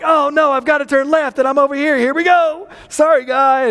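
high-pitched, hammed-up "oops" voice; sheepish mock panic, pitched well up; flustered apology. Driver cutting across lanes to turn left, flustered and apologetic. Acts himself cutting in while driving.

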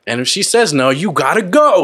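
mock chant, climbing high; imitated street yelling. Quoting New Yorkers on the Cuomo scandal, mock yelling. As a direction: jeer it like a street heckler. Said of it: "people just yelling out shit".

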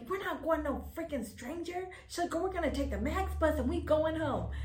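put-on a touch of older-sister sternness; high, firm friend. Best friend insisting they take the bus, high and firm. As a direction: lay down the law in a high voice.

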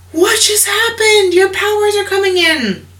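high, excited astonishment; amazed family. Retold or imitated. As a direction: squeal it out like you can't believe your eyes. Family amazed at his strength.

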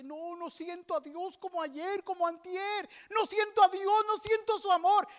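high lament rising to a scream; building despair. Retold or imitated. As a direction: imitate anguish escalating into loud, high cries. Acts friend's escalating despair. (desesperación que crece hasta el grito)

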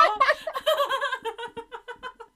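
loud, high cackling laugh; shrieking laugh. Real, not acted. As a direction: burst out laughing loudly. Laughing at the squeaky chair.

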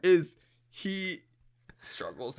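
normal talking voice

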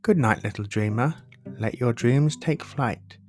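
usual speaking voice

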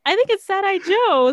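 high, loud retold remark; retold realization. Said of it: "And I'm like".

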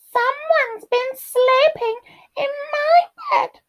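tiny, very high cub wail; crying Baby Bear. A narrator's reading. Baby Bear finds his bed slept in, high-pitched cry. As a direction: squeal it tearfully like a little bear. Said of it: "cried Baby Bear".

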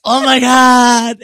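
high, loud, drawn-out mock scream; hammed-up panicked wail; dramatic freak-out. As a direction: imitate a sister-in-law freaking out theatrically. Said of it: "the most dramatic dumbass shit".

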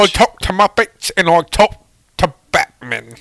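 mock-Cockney old British actor; Michael Caine. Actor boasting about his co-stars, comic Cockney impression. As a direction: brag in a hammy Cockney voice. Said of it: "You gross old British man".

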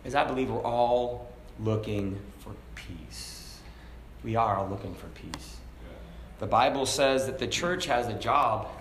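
ordinary talk

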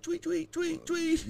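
high, chirpy mock birdsong; bird tweet imitation. Mimics bird call.

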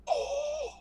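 high amazed sigh; awed oh. Retold or imitated. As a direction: feel the floor shake and marvel. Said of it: "You're all".

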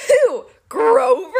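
high, loud disbelief; demanding. A narrator's reading. Captive Clarice hears who the bride is, loudly incredulous. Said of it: "Clarice demanded".

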